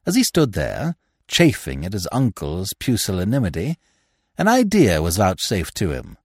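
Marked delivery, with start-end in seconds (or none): none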